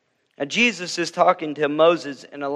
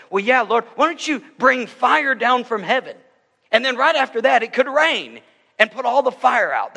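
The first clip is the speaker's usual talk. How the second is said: higher, louder, eager suggestion; eager Elijah; 8 semitones higher, 4 dB louder than usual. Retold or imitated. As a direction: pitch the idea like a thrilled prophet. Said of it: "maybe Elijah said".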